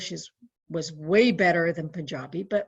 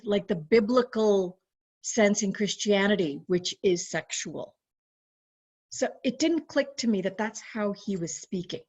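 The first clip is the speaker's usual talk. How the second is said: normal talking voice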